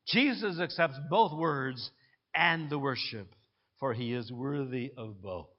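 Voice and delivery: normal talking voice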